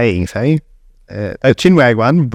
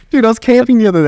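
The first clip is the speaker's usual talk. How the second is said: high, excited storytelling voice; bursting to tell the story; 12 semitones higher than usual. Real, not acted.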